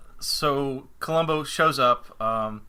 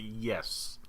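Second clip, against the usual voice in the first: dreamy eager yes; 5 semitones lower, 8 dB quieter than usual. Retold or imitated. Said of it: "I'd be".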